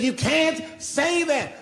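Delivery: very high, slow imitation of an upset woman; upset heckler. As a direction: mimic her protest, stretching each word high. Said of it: "She says".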